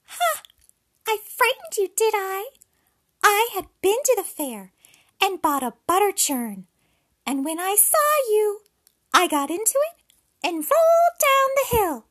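very high, loud gloating pig; little pig voice. Acted. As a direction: crow it out like a cheeky piglet. Pig taunts the wolf.

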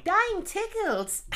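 put-on high, slow childlike voice; Ralph Wiggum voice. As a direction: do a dopey little boy. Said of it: "one of the Ralphs just going".

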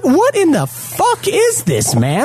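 high, slow, incredulous yell of disbelief; can't believe what you're seeing; exasperated outburst. Retold or imitated. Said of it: "and I'm like".

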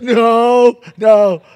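loud high shout; child giving himself away, shouting no. Retold or imitated. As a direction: yell it back without thinking. Hiding kid answers the dad.

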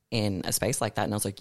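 ordinary talk

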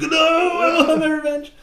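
high, loud anime yell; hammed-up vengeful cry. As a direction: wail it like a melodramatic avenger. Arthur refuses to relax.

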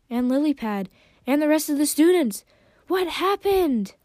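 high, loud, alarmed questions; urgent cry that climbs in pitch; shouting. A narrator's reading. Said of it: "Sunny shouted".

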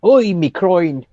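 an Irishman just hit in the balls; pained Irish accent. Acted. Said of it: "I think that was a thick Irish accent".

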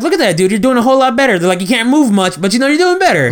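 high teasing encouragement; mock encouraging friends. Retelling friends' backhanded praise.

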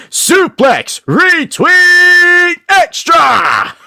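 huge drawn-out wrestling announcer shout; announcer yell. Retold or imitated. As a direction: bellow it like a ring announcer. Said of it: "just yelling into my phone".

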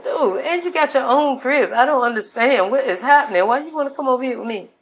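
high, incredulous questioning; exasperated bafflement. Acted. To a relative with their own crib, baffled high protest. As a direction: plead for it to make some sense.

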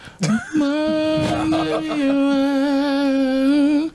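long shrieking laugh; loud high laugh. Real, not acted. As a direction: hoot with laughter. Laughing at the pun.

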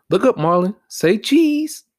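high, loud teasing photographer call; mocking taunt. Vlogger mocking Capitol rioters for the camera, high and teasing. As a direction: taunt like snapping their mugshot.

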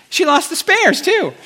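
very high comic squeal of indignation; mock outrage. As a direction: cap the retold rant way up high. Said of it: "I was just so mad".

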